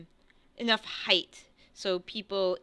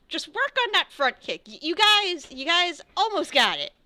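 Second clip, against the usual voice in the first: high, loud coach to students; upbeat encouragement; 10 semitones higher, 7 dB louder than usual. Acted. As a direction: cheer them on loudly. Master encourages his tired students.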